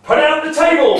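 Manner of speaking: a big high shout with a laugh in it; excited giant shouting orders; giant's cry. Acted. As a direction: bellow the order across the hall. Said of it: "he cries".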